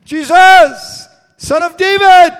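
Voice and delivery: full, high-pitched desperate scream; top-of-lungs yell. Acted. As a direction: scream it out over a crowd. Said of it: "yells at the top of his lungs".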